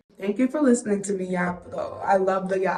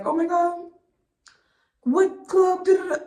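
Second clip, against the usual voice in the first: lightly voiced, much higher, breathless asking girl; mock clueless girl; 10 semitones higher than usual. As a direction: play the girl begging for answers, pitched way up. Mocking girls who ask which club.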